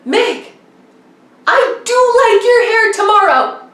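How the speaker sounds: high, loud mock husband reply; husband's voice. Husband answering his crying wife, lightly voiced husband. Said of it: "he's like".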